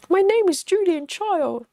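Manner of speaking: put-on high, sing-song Julia Child voice; a playful high-pitched impression of Julia Child. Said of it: "that's my Julian Child impression".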